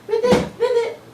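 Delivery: very high little frog voice; high-pitched frog ribbits; Baby Ribbit. Pretend. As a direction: squeak it out as a tiny frog. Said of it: "Baby Ribbit says".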